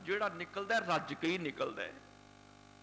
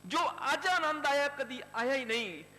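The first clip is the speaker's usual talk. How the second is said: higher and louder, bursting with joy; overjoyed boy; 7 semitones higher, 6 dB louder than usual. Acted. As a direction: let the boy's delight ring out. Boy's joy at feeding the hungry. (ਖੁਸ਼ੀ ਨਾਲ ਉੱਚੀ ਆਵਾਜ਼)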